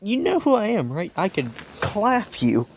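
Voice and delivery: high-pitched disbelieving warning; incredulous hero. Acted. Hero answers a student's challenge, incredulous and high-pitched.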